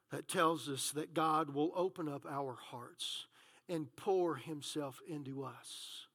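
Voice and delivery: low, very soft, with long pauses; hushed preaching. Real, not acted. Preacher telling the congregation God will pour Himself in, hushed. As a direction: let the promise sink in quietly.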